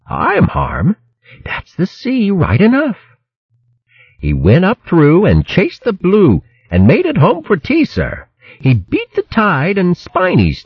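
put-on high, proud young hare. A narrator's reading. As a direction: recite it proudly.

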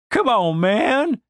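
drawn-out, high-pitched complaint; mildly fed up. Acted. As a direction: whine a little at a friend, slowly. Trapped Denise complains under robes.

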